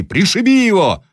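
high-pitched, fierce mob cry; crowd shouting. A narrator's reading. Peasants urging Vanya to kill the captive, shouting. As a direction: cry out for the blow. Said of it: "закричали другие мужики".

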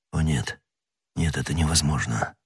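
low, hushed murmur; horrified disbelief. A narrator's reading. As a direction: murmur it to yourself in dread. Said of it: "повторял он". (тихо, потрясённо, себе под нос)

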